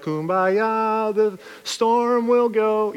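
lightly mocking passive calm; mock dreamy kumbaya. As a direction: float it out lazily like a campfire song. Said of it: "just be like".

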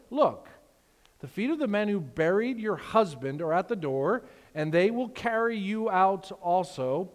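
measured Bible reading to the congregation; scripture reading. Acted. Pastor reading Acts to the church, measured and solemn. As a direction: read the passage out with weight.

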